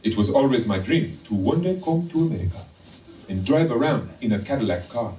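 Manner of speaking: deliberate, lilting mock Zimbabwean accent. Clerk tells a customer of his dream, in a Zimbabwean accent.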